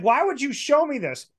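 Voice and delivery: high, disgusted question. Retold or imitated. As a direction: recoil as you ask it. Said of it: "I was like".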